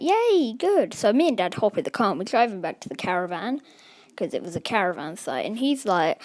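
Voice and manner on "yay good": high-pitched little mock cheer; light, acted-out yay; retold delight. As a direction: cheer the trade, pitched well up. Child pleased with the card trade.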